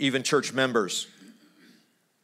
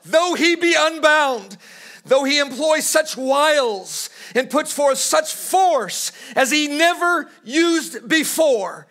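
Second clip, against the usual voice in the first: high, loud and slowing, preaching at full force; fiery preaching; 10 semitones higher, 4 dB louder than usual. Acted. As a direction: thunder it from the pulpit, dragging the words. Preacher at sermon peak.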